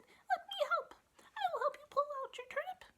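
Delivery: very high, squeaky little voice; tiny mouse voice. Acted. The little mouse offering to help, very high.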